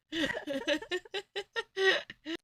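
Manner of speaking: high airy laugh after the song; amused high laugh. Real, not acted.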